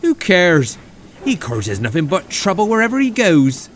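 loud, high, irritated retort; cross little engine brushing it off; dismissive. A narrator's reading. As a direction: snap back indignantly. Oliver dismisses Toad's worry.

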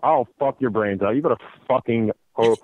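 ordinary talk, nothing marked

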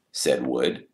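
plain reading voice

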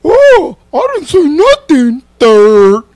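high-pitched mocking whine; mock whiny act. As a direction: pitch way up, drawn-out mocking imitation. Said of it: "acted like".

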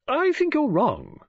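high, slow, firm contradiction; opinionated woman friend. Acted. Woman friend contradicts the proud detective, insistent. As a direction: lift the voice and disagree.

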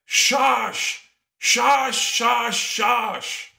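high, loud repeated shushing call; commanding shush. Acted. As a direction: imitate a teacher hushing a rowdy class. Tall teacher shushing the class.

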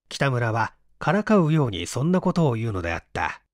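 ordinary delivery, nothing marked

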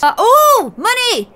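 high, loud delighted cry; giddy money call; excited squeal. Real, not acted. Finds money in game.

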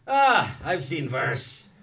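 slow, drawn-out Swiss-accented imitation. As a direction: imitate a seasoned Swiss explorer, unimpressed and slow. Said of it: "He's a Swiss guy".

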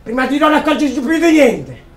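high and loud final cry; impassioned shout. Acted. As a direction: throw the last line out with full passion. Impassioned rant climax.

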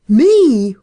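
loud furious roar. Acted. Horse dealer told he was suspected of murder, roaring. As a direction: explode with outrage at the accusation. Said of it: "roared Elkin".

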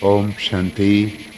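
low, soft and very slow, each word drawn out; slow meditative greeting. Acted. Teacher opens the class calmly with a slow greeting. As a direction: settle the room into stillness.

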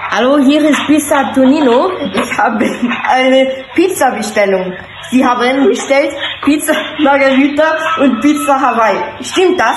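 prank pizza delivery voice. Pretend. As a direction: play a pushy Italian pizza delivery man. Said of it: "gebe mich als Pizzabote aus".

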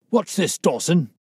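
sharp, loud and high, a bullying cry; angry cry. A narrator's reading. Trainer catching his groom gossiping, loud angry cry. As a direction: bark it out at the servant. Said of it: "he cried".

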